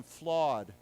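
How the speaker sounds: flawed said loud and clear with a sounded final d; loud model word. Shown as a demonstration. Teacher drilling flawed with students, loud and clear. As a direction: model flawed crisply for the class. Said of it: "let's say it again".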